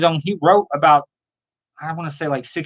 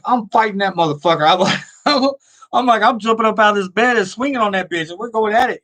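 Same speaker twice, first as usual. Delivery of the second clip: higher-pitched mock tough talk; fired-up retold bravado; 6 semitones higher than usual. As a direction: retell it like an eager kid ready to fight. Retold boast of fighting Jason.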